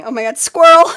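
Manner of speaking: sudden loud surprised cry; squirrel alarm. Real, not acted. Tarot reader spots a squirrel outside mid-stream, startled loud cry. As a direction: blurt it out, caught off guard.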